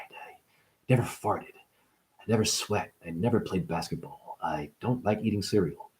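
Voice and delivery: low, very soft, subdued voice; Buddha. Acted. Mocking what a meek holy man would say, hushed low voice. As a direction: murmur it low, almost fading away. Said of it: "Would Buddha say".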